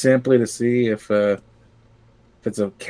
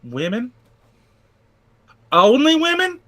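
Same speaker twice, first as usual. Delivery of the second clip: high-pitched Southern character, rising in disbelief; Southern accent; 13 semitones higher than usual. Acted. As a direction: squeal it as a baffled Southerner. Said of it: "I don't know why he's Southern".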